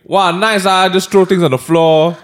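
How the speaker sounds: fake-nice jab meant to shame; passive-aggressive remark. Mocking a litterer in front of friends, sarcastic and loud. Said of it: "very loud passive-aggressive remark".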